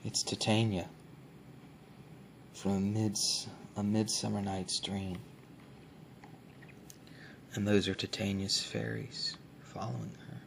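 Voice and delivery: hushed, close soft-spoken ASMR. Acted. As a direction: speak softly, as if close to the mic. ASMR book showing, soft spoken.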